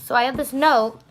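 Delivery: usual speaking voice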